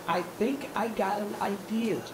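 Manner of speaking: very soft, confiding voice; scheming duck. Acted. Duck floating an idea to the pigeon, confiding. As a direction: share it like a little secret.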